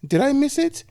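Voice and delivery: put-on very high, sulky old woman; high sulky old-lady question; sulking old lady. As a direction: pout it out high and small. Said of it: "sulked a little and said".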